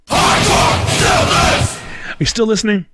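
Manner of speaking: loud high shout at the listeners; sudden shout. Real, not acted. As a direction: jolt the audience awake with a yell. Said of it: "shouts at the audience".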